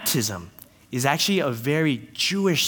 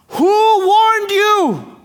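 very high, loud, drawn-out cry; outraged prophet's shouted question; upset shout; 8 dB louder, much slower than usual. Acted. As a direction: bellow an indignant challenge, stretching the words. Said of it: "So John is upset".

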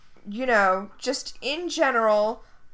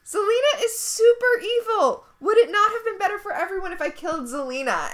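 mock innocent Emma; 8 semitones higher, 3 dB louder than usual. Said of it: "And Emma's like".

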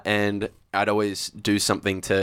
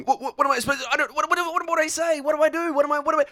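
high, frantic squeaky panic; much higher, rushed stammering panic; panicked babble; much faster than usual. Retold or imitated. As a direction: squeal it like a kid frozen on live TV. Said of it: "holding a microphone in front of a camera going".

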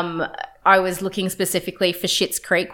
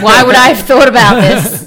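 indignant; 3 semitones higher, 10 dB louder than usual. Real, not acted. Mock indignant reply to teasing.

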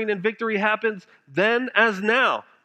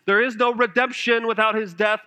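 normal talking voice

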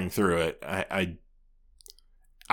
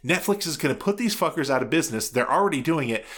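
retold raging; 7 semitones higher than usual. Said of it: "like, raging".

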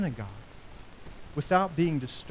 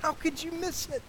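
higher, anguished lament; grieved reproach; 8 semitones higher than usual. Acted. Jesus lamenting over Capernaum, anguished reproach. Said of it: "the language here that Jesus is using".

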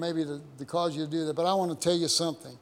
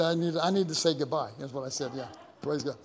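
ordinary delivery

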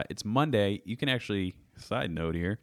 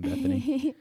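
mock call. Calling her name in funny voice.

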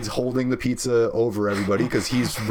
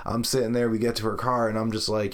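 ordinary talk, nothing marked

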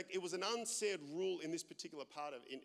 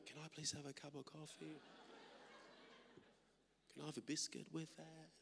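much softer, tiptoeing request; mock hushed voice; 3 semitones lower, 15 dB quieter than usual. Pastor mocking himself ordering quietly, much softer. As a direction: ask for coffee as if afraid to be heard. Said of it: "I just naturally have a loud voice".

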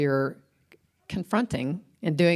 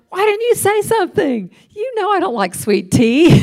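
high, loud, mock-furious complaint to her husband; hammed-up exasperation; exasperated outburst; 9 dB louder than usual. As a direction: replay the indignant wife, much higher and louder. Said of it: "I'm like".

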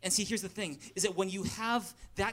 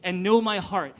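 reading aloud together, loud; loud group reading; 2 semitones higher, 8 dB louder, slower than usual. Real, not acted. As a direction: read scripture out loud with the crowd. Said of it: "Read it in a loud voice with me".

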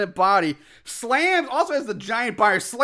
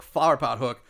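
ordinary talk

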